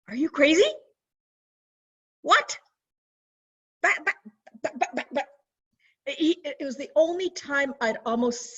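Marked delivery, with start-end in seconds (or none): 0.1-2.6 loud, high what, rising; incredulous. Retold or imitated. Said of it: "he looked at me like". 3.8-5.3 high stammering buts, flustered; sputtering. Retold or imitated. Uncle at a loss for words.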